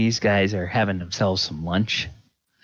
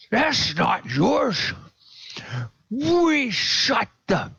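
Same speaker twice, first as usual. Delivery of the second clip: accusing cultist; 14 semitones higher than usual. Acted. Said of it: "pointing an accusing finger at the kobold".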